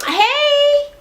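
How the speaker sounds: very high, drawn-out hello; imitated greeting. Paula greets her twin mid-argument, very high-pitched. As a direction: sing it out way up high.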